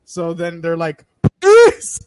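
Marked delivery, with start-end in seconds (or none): 1.4-2.0 sudden high yell of Police; shouted warning. Retold or imitated. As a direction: call it out loud and high like an alarm. Friends shouting warning at thug.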